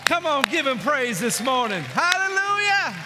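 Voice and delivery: loud, high shouted climax; preaching at full force. Real, not acted. Preacher rouses the congregation to praise, shouting. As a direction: build to a roaring praise call.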